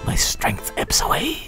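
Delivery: breathy fading whisper. Acted. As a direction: let the voice die away. Medium fading out.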